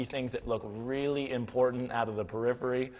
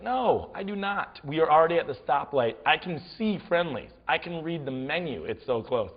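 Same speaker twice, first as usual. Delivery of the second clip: higher-pitched, exasperated protest; emphatic refusal; 4 semitones higher than usual. Retold or imitated. Refusing the driver's dare.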